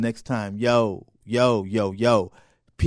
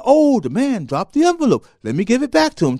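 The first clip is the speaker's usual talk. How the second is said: good thought; 10 semitones higher, 7 dB louder than usual. Retold or imitated. Good thought recalled, higher.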